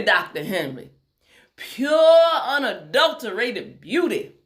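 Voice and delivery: high, emphatic Tennessee surgeon; Tennessee accent. A narrator's reading. Surgeon tells Doctor Henry her aim, emphatic Tennessee accent. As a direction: proclaim it grandly in a Tennessee accent, stretching key words. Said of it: "in a strong Tennessee accent".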